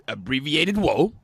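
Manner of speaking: ordinary talk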